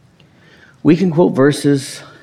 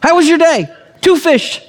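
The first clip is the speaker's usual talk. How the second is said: playful home exchange; 14 semitones higher, 3 dB louder than usual. Acted. Acts out wife and himself.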